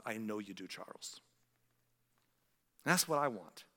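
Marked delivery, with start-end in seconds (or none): 0.1-1.2 softer and lower, ending almost breathless; a tender hushed answer; God's gentle reply. Retold or imitated. God answering Spurgeon, softly and tenderly. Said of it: "he responds".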